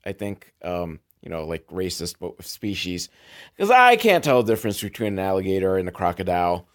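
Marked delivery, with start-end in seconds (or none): 0.0-3.1 quiet aside. Real, not acted. Sheepish aside about species.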